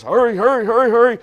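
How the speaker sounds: high, loud, hurried chivvying; frantic urging. Acted. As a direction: urge everyone along in a mock last-minute panic. Acts out rush before Sabbath.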